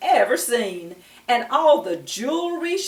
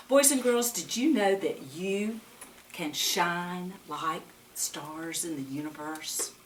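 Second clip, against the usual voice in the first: usual speaking voice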